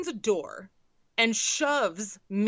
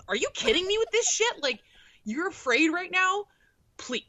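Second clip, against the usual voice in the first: incredulous; 8 semitones higher than usual. Retold or imitated. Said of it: "I'm like".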